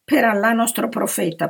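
high-pitched, emphatic protest. A narrator's reading. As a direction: protest your innocence with force. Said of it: "protestò l'uomo". (protesta accorata, a voce più acuta)

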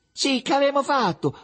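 very high, innocent question; small boy's high, artless voice; candid little boy. A narrator's reading. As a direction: ask it guilelessly, as the littlest one. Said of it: "ripeté candidamente il piccolino".

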